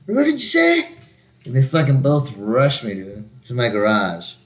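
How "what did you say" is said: very high, imitated challenge; mock outraged reply. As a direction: imitate an affronted neighbor, pitched way up. Neighbors' angry reply, acted out.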